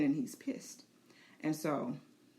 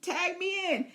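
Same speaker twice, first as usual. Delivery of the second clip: high, keen and raring to fight; pumped-up beast begging to join in; eager dragon voice; 10 semitones higher, 4 dB louder than usual. Pretend. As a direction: play the dragon itching to jump into battle. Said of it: "He's like".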